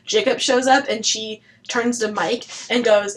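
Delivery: ordinary talk, nothing marked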